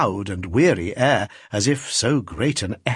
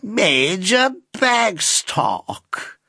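very slow, higher, languid drawl; an old lady's affected drawl; 9 semitones higher, much slower than usual. Acted. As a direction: stretch every word like a faded society lady. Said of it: "drawled the lady in the chair".

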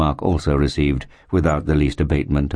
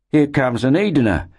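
higher-pitched lift in the voice; cheery companion's announcement; 10 semitones higher than usual. A narrator's reading. Mark spots a local settler approaching, announcing him in character.

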